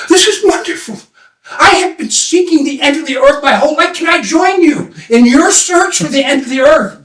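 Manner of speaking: put-on high-pitched, thrilled young seeker; overjoyed. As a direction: beg to join, bursting with delight. Seeker overjoyed at finding companions.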